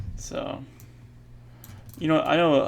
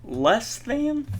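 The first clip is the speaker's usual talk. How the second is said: voice crack; 11 semitones higher, 4 dB louder than usual. Real, not acted. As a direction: voice cracking up high. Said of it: "ooh, voice crack".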